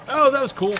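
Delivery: ordinary delivery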